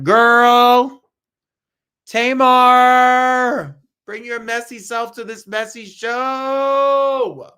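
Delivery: loud, high teasing shout out to a diva; playful call-out. Acted. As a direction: holler her name across the room, then drawl the dare slowly. Calls out to Tamar to come.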